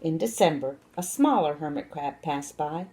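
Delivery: ordinary delivery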